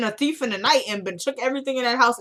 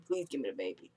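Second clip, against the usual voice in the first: faded pleading; 9 semitones lower, 14 dB quieter than usual. Retold or imitated. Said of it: "pleading, pleading".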